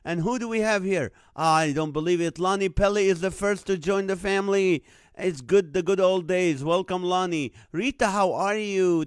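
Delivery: loud, upbeat live show host opening; big announcer energy welcoming each viewer by name; show host welcome. Acted. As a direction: greet the audience like you're opening a TV show.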